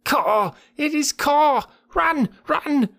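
very high monkey cries; panicked cries. A narrator's reading. Monkeys scattering from the python, panicked high cries. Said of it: "monkeys scattered with cries of".

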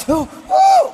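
high, loud mock "ooh"; mock moaning woman. Comic mimicking his lover in bed, high mock moaning. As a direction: moan it out like a hammed-up lover.

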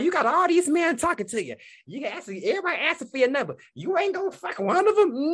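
high-pitched mock friend voice; imitating friend Indy. Friend on the New Orleans trip urging her on, high mock voice. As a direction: squeak it up like a pushy friend. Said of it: "Indy was passing out condoms".

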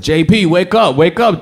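urgent yell to wake him. Retold or imitated. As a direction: shout him awake. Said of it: "I yelled".

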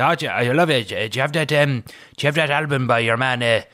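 lightly imitating a thick Cork accent; Cork farmer accent. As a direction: mumble it like a Cork farmer at the till. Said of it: "they'd come up and say".

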